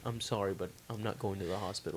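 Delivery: very soft, low apologetic refusal; meek refusal. Retold or imitated. Kid refusing friend's mom the hospital, meek and hushed. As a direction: drop right down low and quiet, politely refusing.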